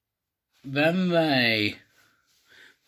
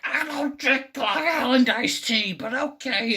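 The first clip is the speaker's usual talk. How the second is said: high-pitched cartoon wife impression; Marge Simpson; 10 semitones higher than usual. Marge answers the guy at the magic show, high put-on voice. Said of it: "Marge is like".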